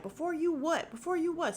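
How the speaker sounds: high, loud challenge repeated; mock shouted retort. Gidget snapping back at him, loud and high.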